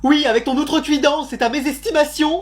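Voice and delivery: high-pitched, haughty formal rebuke; pompous indignation. Acted. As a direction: declaim as an affronted teacher's pet. Pupils put on pompous indignation.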